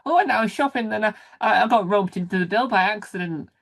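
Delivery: high-pitched mock anecdote, easing down; playful storytelling. Host playing the woman telling her family, playful storytelling. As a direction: put on an excited shopper recounting the day.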